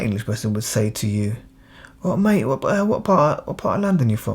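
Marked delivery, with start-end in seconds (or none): 2.0-4.3 high-pitched Cockney mate; chummy Cockney stranger asking a question; Cockney accent. Acted. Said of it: "he's speaking Cockney".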